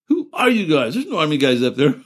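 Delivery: high incredulous mock challenge; mocking the Marines. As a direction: play the baffled Marines, pitch it up. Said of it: "all I can remember saying that these Marine guys".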